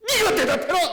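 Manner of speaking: very high, loud demand with a laugh; shouted command. Retold or imitated. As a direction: bellow it at the demon. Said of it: "큰 소리로". (웃음 섞인 큰 호통)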